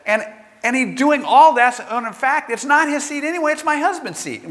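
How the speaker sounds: indignant wife. Retold or imitated. Wife protesting it's her husband's seat, indignant. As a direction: build the mock outrage as she goes on and on.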